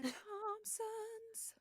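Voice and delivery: high slow echo. Retold or imitated. Echoing her cohost, high and stretched out.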